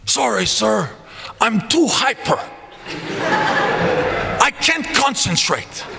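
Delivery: high-pitched imitation of a player; Boris. Player answers his coach's scolding, apologetic put-on high voice. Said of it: "I'm allowed to use his voice".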